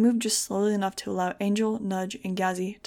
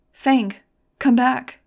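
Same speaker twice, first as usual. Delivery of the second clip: loud, high desperate shout; loud urgent plea at raised pitch; desperate yelling; 5 semitones higher, 11 dB louder, much slower than usual. A narrator's reading. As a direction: yell to call someone back. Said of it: "I was yelling right into it".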